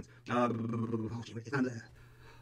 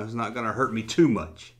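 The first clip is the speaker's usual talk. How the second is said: ordinary delivery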